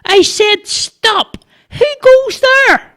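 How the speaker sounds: very high, drawn-out squeaky shout; boy's insistent challenge. A narrator's reading. Boy repeating his challenge to someone unseen, insistent squeak.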